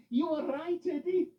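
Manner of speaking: high voice through a laugh. Acted. Loretta laughing to Eddie, amused agreement. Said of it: "laughed Loretta".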